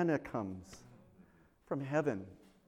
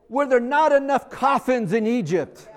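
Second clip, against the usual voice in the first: higher, louder, scoffing half-laugh; mock indignant retort; 7 semitones higher, 7 dB louder than usual. Israelites jeer at Moses over the desert, mock indignant, higher and louder. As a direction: hurl it at Moses with a sneer.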